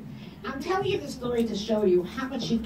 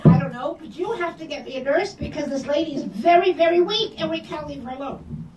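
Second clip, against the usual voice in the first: raised, pressing demand for a nurse; urgent insistence; 6 semitones higher, 6 dB louder than usual. Retold or imitated. Daughter-in-law on the phone demanding a nurse, urgent and loud. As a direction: push hard, she can't be left alone.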